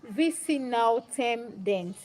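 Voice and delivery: "V C now Tem Dent" read very slowly, word by word; puzzled sounding-out. Real, not acted. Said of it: "I don't understand what you wrote".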